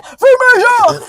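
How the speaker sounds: high shouted curse; shouting. Real, not acted. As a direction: yell it out. Shouted echo of friend.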